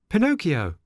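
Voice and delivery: put-on high boy puppet voice; Pinocchio voice. A narrator's reading. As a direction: voice the eager puppet. Pinocchio answers.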